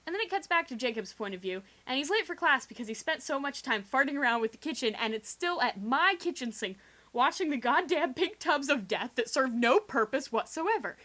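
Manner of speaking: ordinary delivery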